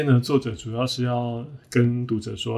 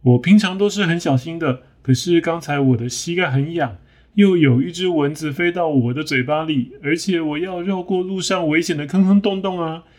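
higher, whiny voice piling up excuses; excuse-making hippo; 6 semitones higher than usual. Acted. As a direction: rattle off excuses defensively. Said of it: "这个河马说".

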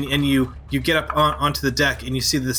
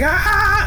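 high, loud scream of pain; burning scream; 5 dB louder than usual. Acted. Burning sailor screams as he leaps up, loud. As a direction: shriek as the fire catches. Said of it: "is like".

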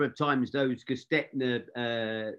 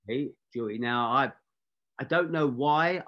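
ordinary talk, nothing marked

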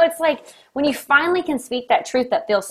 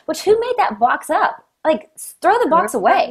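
indignant excitement; 8 semitones higher, 4 dB louder than usual. Real, not acted. Rhetorical outrage at rules.

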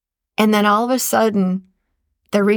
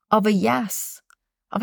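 normal talking voice

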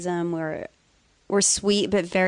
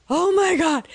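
shocked; 10 semitones higher, 4 dB louder, slower than usual. Retold or imitated. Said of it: "And I was like".

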